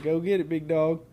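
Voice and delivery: ordinary talk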